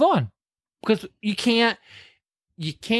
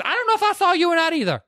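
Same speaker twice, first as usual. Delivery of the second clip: high, fast comeback; mock high sassy reply; 14 semitones higher, faster than usual. Daughter snapping back at father, high and fast. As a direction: squeak it back quickly and cheekily. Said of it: "She goes".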